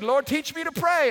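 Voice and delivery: high, loud plea to heaven; hammed-up urgent begging; mock-panicked prayer. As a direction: cry out like a driver about to be caught. Acting a driver's panicked prayer.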